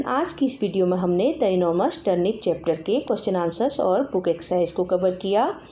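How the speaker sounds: normal talking voice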